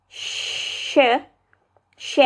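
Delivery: a long hushed "sh"; sh sound. Shown as a demonstration. Vlogger demos Turkish ş: long hushed "sh". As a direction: hiss out a steady "sh". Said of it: "the S with this".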